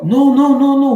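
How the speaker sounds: loud flat refusal; firm refusal. Retold or imitated. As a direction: shut him down hard. Refusing the boyfriend on the phone.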